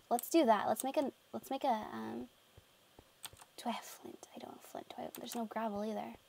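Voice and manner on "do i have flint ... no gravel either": hushed whisper. Real, not acted. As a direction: mutter it in a whisper. Whispering to herself.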